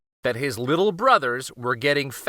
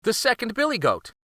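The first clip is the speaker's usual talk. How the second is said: medium-sized goat voice; 10 semitones higher, faster than usual. A narrator's reading. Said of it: "said a medium-sized voice".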